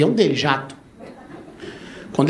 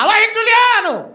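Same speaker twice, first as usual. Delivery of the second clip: grito alto e agudo de volta; resposta de palco; 13 semitones higher, 5 dB louder than usual. Retold or imitated. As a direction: anuncia a dupla com empolgação. (grito animado ao microfone)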